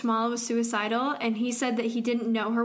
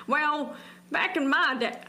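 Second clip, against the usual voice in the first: put-on half-hearted Southern accent, pitched up a little; bad Southern accent; 3 semitones higher than usual. Guessing how the callers sound, attempted Southern accent. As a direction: try a hammed-up Southern drawl, badly faked. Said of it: "can't even do a Southern accent".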